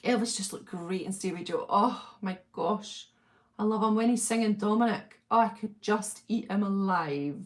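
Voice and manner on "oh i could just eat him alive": ordinary talk, nothing marked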